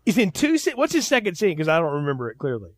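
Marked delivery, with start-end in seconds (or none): 0.1-1.6 surprised. Real, not acted. Surprised at second scene claim.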